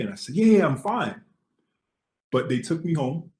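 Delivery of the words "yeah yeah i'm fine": high, loud, breezy insistence; eager reassurance, pitched far up; bright-eyed excitement. Retold or imitated. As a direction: re-enact an overexcited brush-off. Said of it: "I was really bright-eyed and excited".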